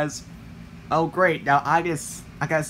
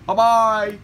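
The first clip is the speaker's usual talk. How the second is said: loud, higher sing-out farewell; newsreader sign-off; 4 semitones higher, 6 dB louder than usual. Acted. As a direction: sign off brightly and loudly. Newsreader signs off.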